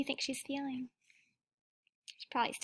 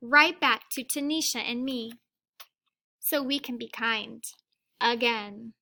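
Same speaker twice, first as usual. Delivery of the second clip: put-on reading a picture book aloud to children; gentle read-aloud storytelling; teacher reading to the class; story reading; 9 dB louder, slower than usual. A narrator's reading.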